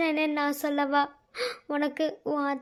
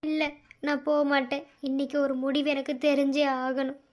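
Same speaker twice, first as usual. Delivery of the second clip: usual speaking voice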